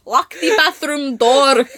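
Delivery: put-on slow, lightly accented German command; a hint of a bossy German; mock German accent. Mock German telling her to lock the door, lightly accented. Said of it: "the bad German accent".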